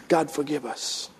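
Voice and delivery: low, soft prayer; hushed plea. Real, not acted. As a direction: murmur a quiet prayer.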